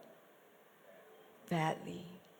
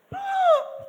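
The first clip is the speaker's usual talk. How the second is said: a sharp, very high gasp; big theatrical gasp; dramatic gasp; 6 dB louder than usual. Pretend. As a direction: gasp in mock shock. Audience prompted dramatic gasp.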